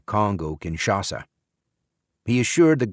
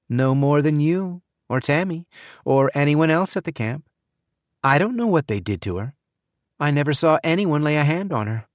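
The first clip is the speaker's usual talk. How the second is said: put-on young woman voice; 7 semitones higher than usual. A narrator's reading. Maggie voiced by narrator.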